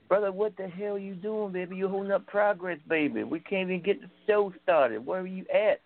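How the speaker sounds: Dusty Rhodes. Acted. Prank caller hounding a late colleague, Dusty Rhodes impression. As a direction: scold him in a high-pitched impersonation. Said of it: "impersonating Dusty".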